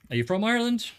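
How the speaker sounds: a hint of a terrible Irish accent on Ireland. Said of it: "put on an Irish accent".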